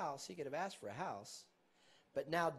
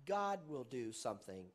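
ordinary talk

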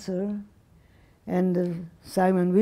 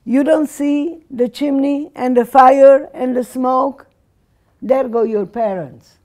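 put-on loud, harsh shout at the girl; retold yell; 4 semitones higher, 8 dB louder than usual. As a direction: bark it out, furious and cruel. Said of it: "she yells at me".

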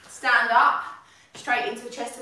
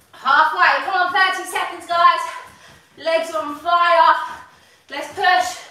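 loud, higher rallying call; shouted coaching; 4 semitones higher, 6 dB louder, slower than usual. Real, not acted. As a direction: pump the class up, shouting. Coach pushing class through set.

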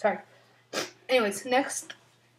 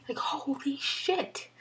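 awed whisper; 5 semitones higher, much slower than usual. Real, not acted. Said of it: "whispered awe".

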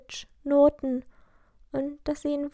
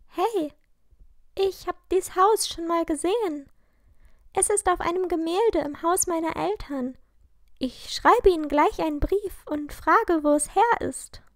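higher and louder, eager; bursting with the discovery; excited girl, higher and louder; 5 semitones higher, 4 dB louder than usual. A narrator's reading. Lilly excited about painting.

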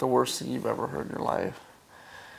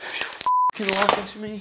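shrill pleading outrage; 5 semitones higher, slower than usual. Real, not acted. Boyfriend pleads she is lying.

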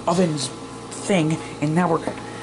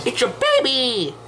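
put-on higher, exclaimed with a stretched baby; oddly voiced baby; 7 semitones higher, slower than usual. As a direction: cry out baby in an odd high voice. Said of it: "why did you say it like that".

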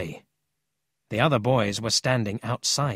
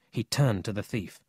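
ordinary delivery, nothing marked

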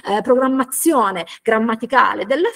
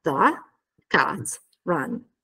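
THE, CAT, RUN separated, lower pitch; word-by-word; 6 semitones lower, 4 dB quieter, much slower than usual. Shown as a demonstration. Teacher assembling the answer's structure, word by word. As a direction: lay out THE CAT RUN piece by piece. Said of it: "aggiungiamo la frase di domanda".

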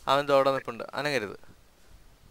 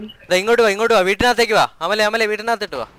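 calling out; 7 semitones higher, 8 dB louder than usual. Real, not acted. Calling teammates into the house.

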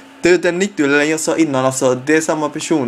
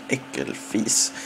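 whispered insult; 2 semitones lower, 9 dB quieter, much slower than usual. Real, not acted. Muttered insult at scorer.

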